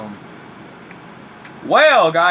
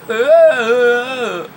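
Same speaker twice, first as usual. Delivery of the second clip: high, slow, strained voice; 11 semitones higher, 9 dB louder than usual. Real, not acted. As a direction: stretch it out like the voice is giving out. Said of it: "you lose my voice".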